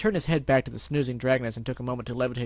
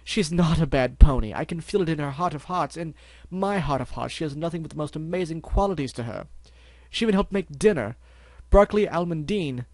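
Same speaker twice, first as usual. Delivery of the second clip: pleading; 3 semitones higher, 6 dB louder than usual. Acted. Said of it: "hooves begging at Dusk's feet".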